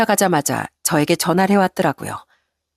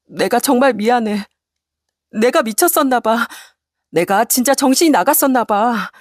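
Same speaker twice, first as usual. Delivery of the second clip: high, pleading apology; begging wife; 4 semitones higher than usual. Acted. Wife begging her husband not to leave, pleading. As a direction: beg him for forgiveness. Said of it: "애원하는 아내를".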